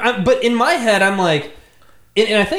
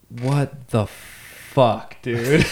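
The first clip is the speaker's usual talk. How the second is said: very slow, word by word, lower pitch; slow stunned disbelief; 3 semitones lower, much slower than usual. Retold or imitated. Storyteller rereading his own email, slow stunned disbelief. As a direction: drag each word out in dismay.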